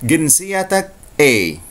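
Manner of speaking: slow, drawn-out Egyptian Arabic "Ginsiyatak E". Shown as a demonstration. Teacher showing students the Egyptian Arabic phrase. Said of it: "Itu bahasa Amiyah Mesir".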